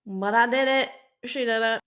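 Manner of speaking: loud proud cheer; triumphant. Real, not acted. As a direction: celebrate pulling it off. Triumph after tricky liner.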